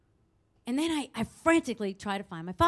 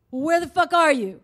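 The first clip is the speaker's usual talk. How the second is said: loud, higher impatient demand; mock angry bark down the phone; imitated irate caller; 4 semitones higher, 7 dB louder than usual. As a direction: act out the caller losing patience. Impatient caller demands her whereabouts.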